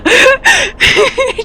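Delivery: squealing laughter far up in pitch; helpless high laughter. Real, not acted. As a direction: lose it completely, laughing high. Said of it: "I can't stop laughing".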